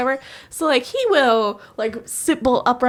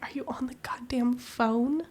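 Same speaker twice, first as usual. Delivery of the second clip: incredulous whisper; hiss-free soft whisper of disbelief; 9 dB quieter than usual. Retold or imitated. Wife whispering to her husband on a pretend phone call. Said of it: "I was like".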